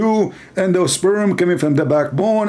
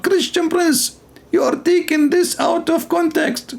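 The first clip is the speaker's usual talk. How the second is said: a high, silly heckler cutting in; high mock caller voice; 10 semitones higher, slower than usual. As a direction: squeak in as an indignant caller.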